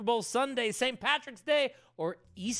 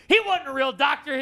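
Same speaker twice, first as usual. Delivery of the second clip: emphatic reveal; 6 semitones higher, 8 dB louder than usual. Real, not acted. Exposing a trivia fact.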